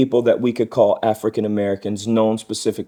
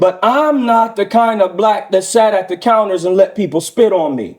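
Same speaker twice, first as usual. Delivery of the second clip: interpreted indignant man; 7 semitones higher, 3 dB louder than usual. Acted. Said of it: "this is my interpretation".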